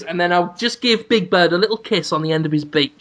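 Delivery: ordinary delivery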